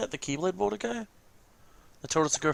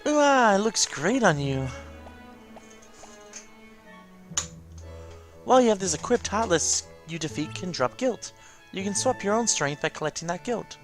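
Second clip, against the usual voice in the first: higher-pitched companion voice, admiring then explaining; encouraging familiar character; 8 semitones higher than usual. Acted. Familiar showing the player how the gift works, encouraging. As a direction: play the helpful game mascot.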